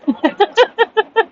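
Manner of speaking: real high-pitched laughing fit. Real, not acted. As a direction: crack up at the memory. Recalls friend's joke.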